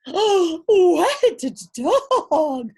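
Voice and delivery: loud high cry of alarm; lady shrieks at the wet dog; cried out. A narrator's reading. Lady startled by the wet dog, crying out. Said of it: "cried the lady".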